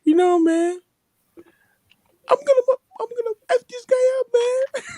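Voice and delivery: high mock sob with a pause before the threat; crying man. Retelling the man's little pause before threatening, mock crying. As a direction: imitate him choking up mid-threat. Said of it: "like, that little pause".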